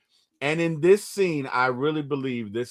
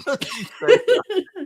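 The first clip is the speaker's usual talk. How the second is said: higher, laughing through the words; laughing repeat; 5 dB louder than usual. Shown as a demonstration. Laughing at his co-host, repeating the warning. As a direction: crack up while repeating it.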